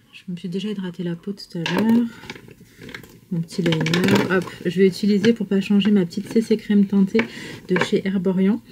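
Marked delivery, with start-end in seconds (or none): none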